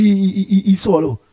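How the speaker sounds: usual speaking voice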